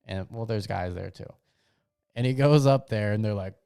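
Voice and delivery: ordinary talk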